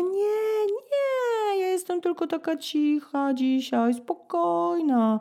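very high, timid little voice; tiny high falsetto, spokojna stretched slowly; meek excuse. Retold or imitated. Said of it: "zaczynam się tłumaczyć".

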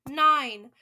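loud, emphatic count; forceful announcement; countdown call. A narrator's reading. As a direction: boom out the number. Witch counts down aloud.

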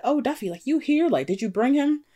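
high, delighted greeting; excited surprise. Retold or imitated. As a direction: greet him with girlish delight. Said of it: "Ernestine's like".

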